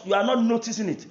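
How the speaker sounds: ordinary talk, nothing marked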